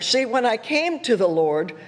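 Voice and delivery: ordinary delivery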